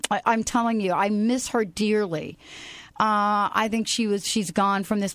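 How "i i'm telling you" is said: ordinary talk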